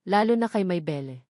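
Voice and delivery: plain reading voice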